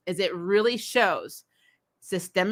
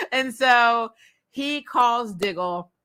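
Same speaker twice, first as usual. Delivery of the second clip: usual speaking voice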